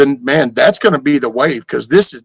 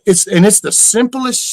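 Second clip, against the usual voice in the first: normal talking voice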